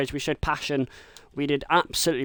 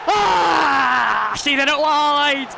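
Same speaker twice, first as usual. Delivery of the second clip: loud, higher yelp of delight at the miss; thrilled shout; 10 semitones higher, 7 dB louder, much slower than usual. Acted. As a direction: shout it like the rival just blew it. Rival misses penalty.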